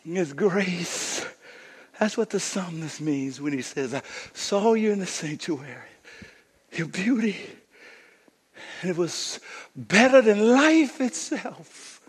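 soft, slowed sermon with long pauses; hushed preaching. Real, not acted. Preacher telling listeners God wants to be found, hushed and slow. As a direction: let it sink to a tender hush.

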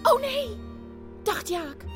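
usual speaking voice

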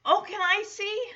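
high-pitched imitation of an innocent little girl; all innocent. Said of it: "all innocent".

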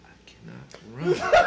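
breaking into a laughing whisper; hushed, comically helpless confession. Retold or imitated. Storyteller telling Erica he cannot run, helpless whisper.

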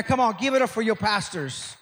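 loud, emphatic pulpit praise; preaching praise. Real, not acted. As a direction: hype up the pastors to the crowd.

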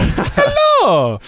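very high drawn-out falsetto; cartoon plumber's greeting; Mario. Shown as a demonstration. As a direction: squeal it in a game-character voice. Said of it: "I got a Mario".

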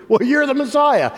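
high, eager blurt as Peter. Retold or imitated. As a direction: Peter speaking first, thinking later.

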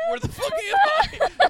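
high, slow, laughing mock daze; slow, high, half-laughing confusion; dazed, through laughter. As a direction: play the woman coming to, cracking up. Knocked-out woman wakes confused.

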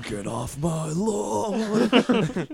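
slow, high, laughing get-off-my-lawn; laughing old man. Retold or imitated. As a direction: crack up while doing the old man. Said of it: "Gran Torino".

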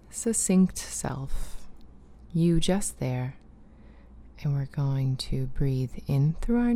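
guided breathing voice; guided breathing intro. Acted. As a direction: lead them gently into the exercise. Leads a breathing exercise.

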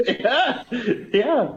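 laughing. Real, not acted. Laughing at own joke.

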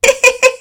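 shrill giggle at own joke; Penelope laughing alone at dinner; high burst of laughter. A narrator's reading. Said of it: "the only one laughing".

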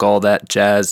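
usual speaking voice

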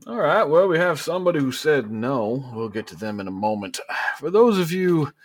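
high, loud, pompous politician addressing the crowd; a showman calling for the yes votes; Governor Bordeaux. Acted. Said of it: "Governor Bordeaux goes".

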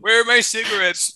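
put-on high, cranky old man asking. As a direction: whine like a grandpa. Playing grandpa voice.

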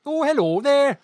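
bright high Welsh greeting, loud; Welsh accent. Pretend. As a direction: sing it out in a Welsh lilt. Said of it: "Can anyone do a Welsh accent?".

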